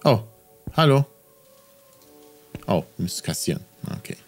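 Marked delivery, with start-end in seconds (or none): none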